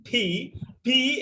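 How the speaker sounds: ফি drawn out very slowly with a strong push; exaggerated pronunciation. Shown as a demonstration. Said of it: "একটু ঢং করে উচ্চারণ করবেন".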